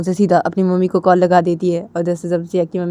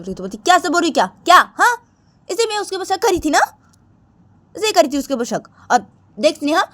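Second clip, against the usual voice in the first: put-on angry girl; 8 semitones higher than usual. Ritu to Sneha on the phone, ending their friendship, scolding. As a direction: tell her off and cut her off for good.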